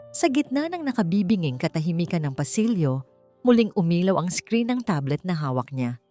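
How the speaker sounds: ordinary delivery, nothing marked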